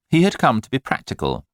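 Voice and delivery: usual speaking voice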